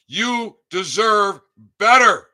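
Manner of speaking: high, loud and slow yell; loud shout. Real, not acted. Telling listeners they deserve better, slow high shout. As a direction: belt out "You deserve better." slowly at the top of the voice. Shouted, then repeated quietly.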